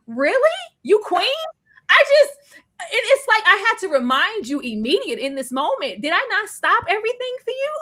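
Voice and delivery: high, loud, indignant; loud, high outrage at being left behind; high indignant outburst. Retold or imitated. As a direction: shriek it at him in disbelief. Lestat confronting Louis, retold.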